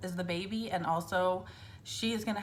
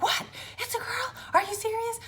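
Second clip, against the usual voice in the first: much higher pitch, thrilled disbelief; surprised squeal. Retold or imitated. Mother learning at ultrasound it's a girl, surprised squeal. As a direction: squeal it like you can't believe it.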